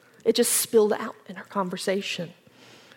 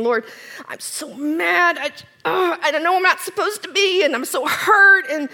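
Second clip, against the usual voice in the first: higher, pleading prayer with a catch of tears; retold tearful prayer; 6 semitones higher than usual. Wife retelling her hurting prayer on a walk, tearful. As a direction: pour out hurt to the Lord.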